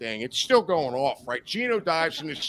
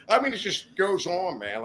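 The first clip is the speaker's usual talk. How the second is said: ordinary delivery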